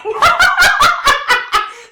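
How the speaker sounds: high, loud laugh-sob; loud tearful laugh. Real, not acted. Medium overcome with emotion on stage, loud laugh-sob. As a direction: let a high crying laugh break out. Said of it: "you're not supposed to be crying".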